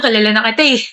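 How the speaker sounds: ordinary delivery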